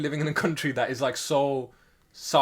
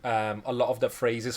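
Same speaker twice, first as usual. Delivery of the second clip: normal talking voice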